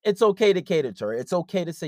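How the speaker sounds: usual speaking voice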